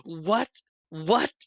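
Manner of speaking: high, rising incredulous question; mock disbelief. Teammate hearing about the signed ball, high mock disbelief. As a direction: imitate a stunned kid, pitching it up. Mimics teammate's stunned reaction.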